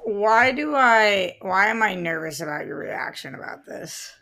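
ordinary talk

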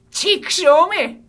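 long, high drawn-out cry of frustration; mock shout. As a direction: yell it out like a cartoon villain cursing. ふざけて叫ぶ. (大げさにふざけて叫ぶ)

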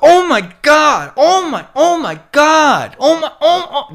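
very high, loud hammed-up shrieks; mock-horrified squeal, drawn out; mock shocked Angelenos. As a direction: imitate a panicked freak-out in a squealing falsetto. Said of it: "And they're like".

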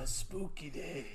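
slow spooky sign-off at normal volume; playful spooky voice. Pretend. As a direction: draw it out eerily without shouting. Spooky sign-off, first take.

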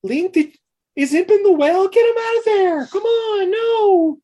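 very high, loud frantic cry; panicked shrieking. Retold or imitated. Woman realises Imp is in the well, frantic high cry. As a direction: scream in panic for the baby.

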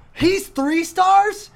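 put-on very high, slow, incredulous kid; kid's disbelief. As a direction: stretch it out high like a stunned boy. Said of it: "He's like".

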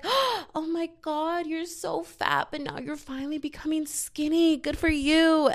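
imitating over-excited congratulations; gushing praise. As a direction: gush like a backhanded well-wisher. Mimics people praising weight loss.

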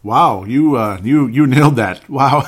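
loud, thrilled praise; excited. Real, not acted. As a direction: burst out with delight. Host reacts to audio submission.

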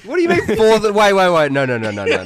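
loud, urgent disbelief; loud protest. Real, not acted. Host cuts in, loudly demanding they go back. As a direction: stop them loudly, shocked. Shocked protest about Fourth of July.

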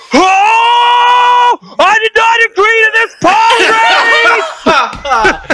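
very high, drawn-out comic scream; panicked yell. Acted. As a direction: yell as if launched out of control. Acts pilot launched by pod boost.

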